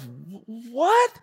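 high startled what; shocked kid. Retold or imitated. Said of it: "I thought to myself".

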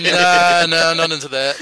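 loud flat no, brushing it off; loud dismissive refusal. Retold or imitated. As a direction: shut the idea down loudly. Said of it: "It's like".

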